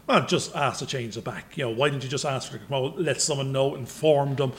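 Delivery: soft, low, reasonable voice; calm and quiet. Retold or imitated. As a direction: talk it through gently, quietly.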